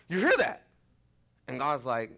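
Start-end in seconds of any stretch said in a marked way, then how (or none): 0.1-0.7 incredulous challenge. Real, not acted. Challenging congregation.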